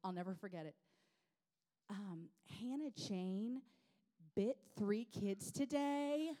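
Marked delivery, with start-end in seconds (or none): 2.6-6.3 slow, high Southern teacher imitation; imitated Southern sweetness breaking bad news; sweet Southern voice. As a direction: draw it out in sugary Southern. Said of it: "in her sweet Southern voice".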